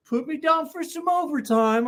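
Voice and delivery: very high-pitched put-on voice; Steve impression. Imitating Steve asking for overtime, high mock voice. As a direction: pitch it way up, mocking. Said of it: "it's like talking to Steve".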